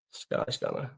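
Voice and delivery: breathy whispered repeat of "Sky Scanner". Shown as a demonstration. Repeats site name under breath.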